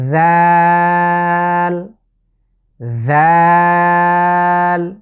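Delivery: জাল drawn out, soft lisped z; soft Arabic dhal. Shown as a demonstration. Teacher shows viewers the soft letter dhal. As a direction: stretch জাল with tongue between teeth. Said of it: "পাতলা বা soft করে আমরা উচ্চারণ করতে হবে".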